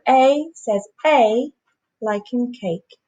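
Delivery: slowed long vowel, said clearly; slow teaching demo. Shown as a demonstration. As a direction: stretch the long A for the class. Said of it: "the long vowel sounds where the letter says its name".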